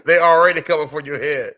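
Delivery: loud, high, laughing tease; tickled, laughing out the words. Real, not acted. Laughing at co-host's rant.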